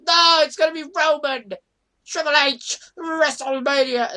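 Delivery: loud hyped-up announcer call; mock commentator hype. Imitating an announcer hyping the main event, mildly loud. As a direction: sell it like a commentator. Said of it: "You had Michael Cole going".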